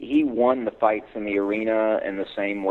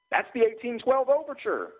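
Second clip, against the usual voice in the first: higher, confusion turning to delight; confused delight. Acted. Clerk hearing rescue music, confused then delighted. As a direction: realize it with growing joy. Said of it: "in a state of confusion that quickly turned to pure delight".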